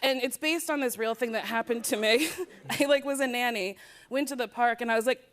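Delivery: ordinary talk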